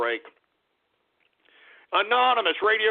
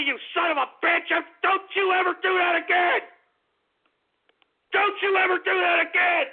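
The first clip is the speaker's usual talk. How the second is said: enraged yelling; 8 semitones higher than usual. Acted. Enraged rant at a troll.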